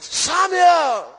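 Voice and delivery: high, loud call from on high; God calling. Acted. God calling young Samuel, high loud call. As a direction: call the boy's name out loud.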